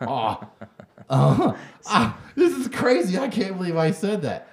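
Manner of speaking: high, loud, hammed-up shock; mocking fake apology. Mocking the comedian's apology to his cohost, hammed-up shock. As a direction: ham up a phony gasp of regret. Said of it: "and say".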